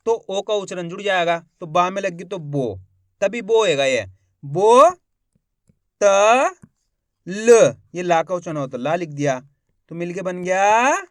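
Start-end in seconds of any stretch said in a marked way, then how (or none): 4.4-7.7 long, loud separate syllables; stretched spelling drill. Shown as a demonstration. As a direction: sound out each piece with pauses between. Teacher sounding out the syllables.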